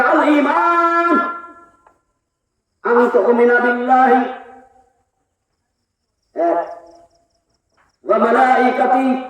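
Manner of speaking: each Arabic phrase drawn out slowly with pauses; measured teaching recitation. Shown as a demonstration. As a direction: recite it slowly, word by word, for them to follow. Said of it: "রসুলের সংজ্ঞাটা বললেন".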